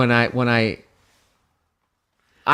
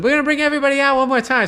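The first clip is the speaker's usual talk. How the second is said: show host; 11 semitones higher, much faster than usual. Retold or imitated. Voices the show's announcer.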